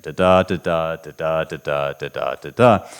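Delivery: da da da da beaten out as three iambic chunks; scanning the meter. Shown as a demonstration. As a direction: mark each foot with da da da da. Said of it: "three different chunks of basically iambs".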